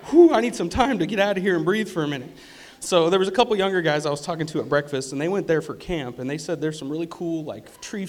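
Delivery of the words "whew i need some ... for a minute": loud, higher-pitched plea for a breather; overwhelmed. Retold or imitated. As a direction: puff it out, louder, needing a break. Acting out need for a break.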